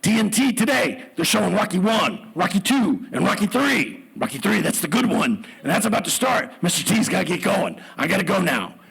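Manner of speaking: Mister T. Retold or imitated. Celebrity excusing himself from reporters, punchy bluster. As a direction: bark each phrase out like a TV hero. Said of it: "Mister T goes".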